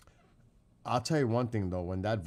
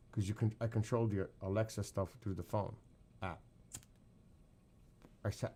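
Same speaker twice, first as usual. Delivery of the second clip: ordinary talk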